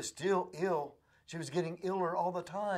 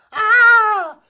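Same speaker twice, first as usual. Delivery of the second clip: very high, loud shriek; piercing scream of pain; 22 dB louder than usual. Acted. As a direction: shriek as the snake bites. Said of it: "and she screamed".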